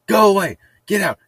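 high, loud yell at birds; retold shooing shout. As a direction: shoo birds away with a yell. Retold shouting at birds while clapping.

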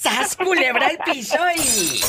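high, called-out catchphrase. Acted. Host calling the show catchphrase to caller, high. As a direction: sing out the show's catchphrase.

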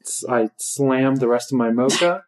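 ordinary talk

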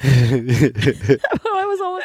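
laughing through the words; half laughing, stretched out. Real, not acted.

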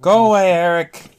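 loud angry shout. Acted. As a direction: yell it at him. Acted story character shouting.

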